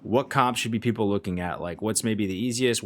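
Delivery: ordinary talk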